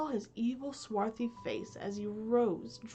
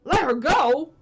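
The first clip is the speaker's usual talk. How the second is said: loud, high outraged question; 6 semitones higher, 14 dB louder, slower than usual. Acted. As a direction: shout it back in outrage. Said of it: "cried Hook".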